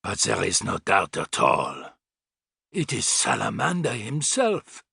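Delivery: put-on grave German-accented pronouncement; harsh Teutonic accent. A narrator's reading. Thin man confirming Salamander to the others, Teutonic accent. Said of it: "in a harsh Teutonic accent".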